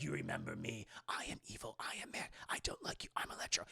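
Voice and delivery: menacing villain whisper; Electro whisper. Retold or imitated. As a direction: hiss it like a creepy supervillain. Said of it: "and Metro's like".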